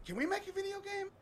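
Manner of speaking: squeaky high mock pitch; silly high voice.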